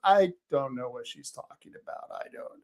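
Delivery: low, soft mock disclaimer; hushed retold denial, trailing away; imitated quiet denial. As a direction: drop the voice right down, fading to almost nothing.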